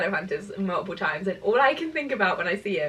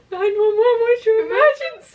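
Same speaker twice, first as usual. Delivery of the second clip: mock 911 dispatcher; 11 semitones higher, 7 dB louder than usual. Mock dispatcher while laughing.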